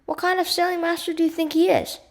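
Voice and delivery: loud, high indignant outburst; exploding. A narrator's reading. Nat barks at Elizabeth over her father's instruments, exploding. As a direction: explode in affronted disbelief, pitch jumping up. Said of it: "Nat exploded".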